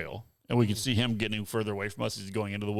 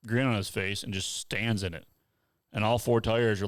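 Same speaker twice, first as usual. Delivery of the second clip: ordinary delivery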